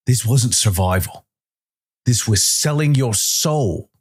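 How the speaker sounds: put-on a man condemning his old captain; bitter accusation. A narrator's reading. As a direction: spit out the betrayal. Calder confronts Winters angrily.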